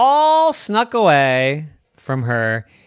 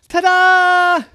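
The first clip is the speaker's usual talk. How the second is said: high, loud drawn-out fanfare; triumphant ta-da; 9 dB louder than usual. A narrator's reading. Little gymnast announces herself to the family, triumphant. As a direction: belt it out like a little show-off. Child showing off before sitting.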